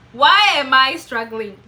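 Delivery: loud, exasperated repeat; emphatic self-questioning. Real, not acted. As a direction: push the question out forcefully.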